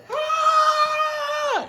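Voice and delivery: very high wordless scream; crowd scream. Acted. Prophets screaming for Baal, high shriek. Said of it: "they're screaming for Baal".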